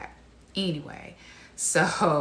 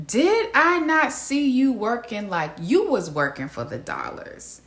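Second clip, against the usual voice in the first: sarcastic disbelief; 5 semitones higher, 4 dB louder, slower than usual. Acted. Sarcastic questions at Olajuwon.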